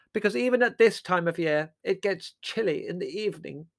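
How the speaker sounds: high-pitched, oblivious chatter; dotty aunt. A narrator's reading. Aunt Fan on chilly evenings, dotty aunt.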